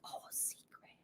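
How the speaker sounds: hushed mock whisper, breath only. Mocking the idea of hiding a concealed gun, teasing whisper. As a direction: breathe it like a silly secret. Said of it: "so it doesn't have to be like".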